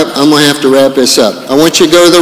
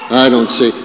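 ordinary talk

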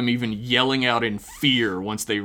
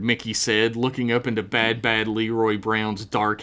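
ordinary talk

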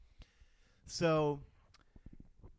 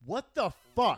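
loud outburst; 9 semitones higher, 8 dB louder than usual. Retold or imitated. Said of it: "And I'm like".

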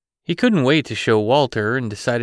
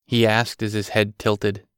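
ordinary delivery

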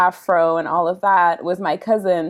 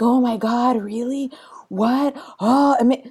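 mildly hammed-up shock, pitched higher; astonished reaction; 4 semitones higher than usual. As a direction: act out a shopkeeper's disbelief. Shopkeeper's astonished reaction retold.

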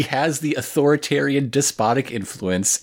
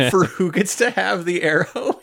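usual speaking voice

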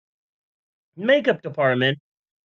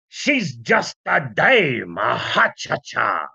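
put-on slow, dismissive old Hollywood tone; old-timey boss voice; 6 semitones lower, slower than usual. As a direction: sneer like a forties studio boss.

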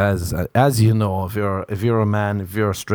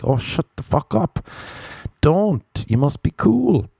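higher, scornful inner voice snapping at him; scolding inner voice; 7 semitones higher than usual. Pretend. His own brain telling him to shut up, scornful. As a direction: slap yourself down, don't you dare.

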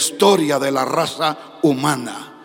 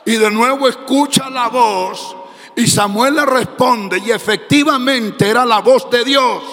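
full-force preaching; 3 semitones higher than usual. Acted. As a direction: preach it out to the young people, climbing in force.

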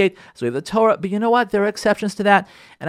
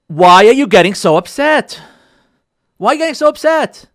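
loud, raised-pitch exasperated demand; upset-spouse challenge; 6 semitones higher, 7 dB louder than usual. Acted. Spouse confronting the other spouse, exasperated. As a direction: demand an explanation from your spouse, loudly. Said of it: "what you hear from your spouse".

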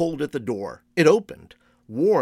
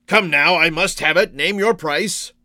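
raised, pressing voice; louder, higher, impatient haggling; insistent demand; 4 semitones higher, 6 dB louder than usual. A narrator's reading. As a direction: push hard for the sale. Man demands the mask.

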